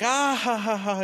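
high, loud mock laugh falling away; retold laugh. Husband laughing off the doctor's news, hammed-up laugh. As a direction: act out a big disbelieving laugh. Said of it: "'Cause I'm still laughing".

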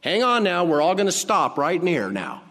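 loud, high command to halt; commanding call. Acted. Jesus calling the funeral procession to halt, loud and commanding. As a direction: call out for everyone to stop.

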